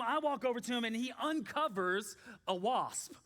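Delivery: ordinary talk, nothing marked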